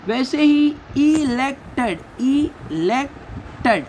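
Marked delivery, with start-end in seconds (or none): 1.0-2.0 "इलेक्टेड" in three detached beats; split into syllables. Shown as a demonstration. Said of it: "वैसे divide होगा". 2.2-3.9 "इलेक्टेड" slowly, with long gaps between syllables; split into syllables. Shown as a demonstration. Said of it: "वैसे divide होगा".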